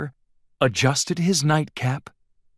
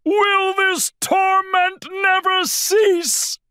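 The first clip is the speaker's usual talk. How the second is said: slow, high despairing wail; 4 dB louder, slower than usual. A narrator's reading. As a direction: wail in theatrical torment. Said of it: "wailed Bear".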